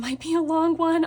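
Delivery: normal talking voice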